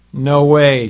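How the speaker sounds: loud, slow, emphatic "No way"; the woman refuses her husband, loudly; loud refusal. Acted. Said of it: "she replied".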